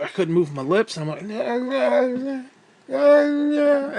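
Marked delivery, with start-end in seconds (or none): 1.3-4.0 high, loud numb-lipped nah nah; frozen lip babble. Retold or imitated. Mimicking his frozen lips, loud and high.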